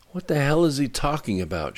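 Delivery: shouted. A narrator's reading. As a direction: call it out over the rumbling, lightly. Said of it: "shouted Riker over the rumbling sounds".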